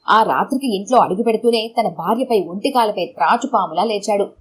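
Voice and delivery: usual speaking voice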